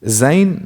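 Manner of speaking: "Zayn." said with the mistaken n and a rise; wrong pronunciation. Shown as a demonstration. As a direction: model the error "Zayn.". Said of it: "No, that's wrong".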